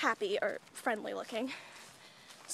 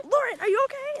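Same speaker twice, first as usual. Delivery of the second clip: high, louder mock-concerned question; mock worried; 9 semitones higher, 5 dB louder than usual. Teasing her friend, she hams up worried concern, higher and louder. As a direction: overplay the worry for a friend choking. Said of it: "and I'm like".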